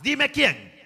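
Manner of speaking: high, loud sharp demand; demanding boss. Retold or imitated. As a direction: insist hard on knowing who. Boss demands the rival's name.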